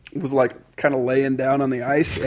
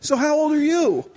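high gentle voice; high voice for a little kid; high question; 12 semitones higher than usual. Retold or imitated. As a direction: ask like talking to a small child. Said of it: "I'm like".

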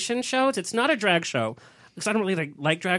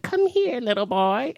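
mock witch, starting high then dropping; 4 semitones higher, slower than usual. Mom in witch costume luring the bully, mock witch voice. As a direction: beckon like a storybook witch, high at first. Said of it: "She dressed up as the witch".

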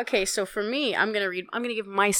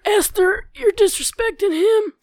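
zealous Trump fan; 8 semitones higher than usual. Acted. Mock Trump fan scolding.

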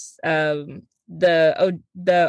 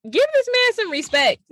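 much higher, hammed-up outrage; mock indignation; 14 semitones higher than usual. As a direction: defend him in an over-the-top squeal. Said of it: "I was like".

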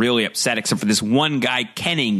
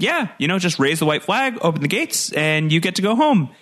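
lightly voiced persuading; airy reassurance; higher, breezy reassurance; 6 semitones higher than usual. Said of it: "And he's like".

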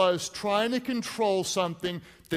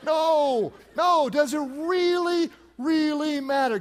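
emphatic laughing denial; 6 semitones higher, slower than usual. Real, not acted. Pastor laughingly rejects a listener's objection. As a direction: shake off the objection playfully.

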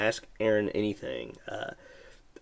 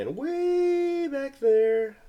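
high slow eerie drawl; spooky voice; much slower than usual. Pretend. Showing dark far room.

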